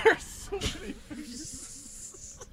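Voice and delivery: high, slow, laughing words. Real, not acted. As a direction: drawl it out while giggling. Laughing at the hands.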